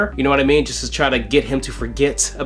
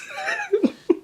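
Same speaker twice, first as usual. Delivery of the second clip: laugh; 5 dB quieter than usual. Real, not acted. Laughing at own mistake.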